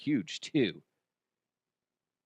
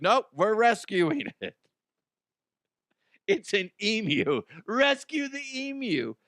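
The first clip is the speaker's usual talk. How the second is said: eager rescuer; 9 semitones higher than usual. Retold or imitated. Friend insisting on rescue.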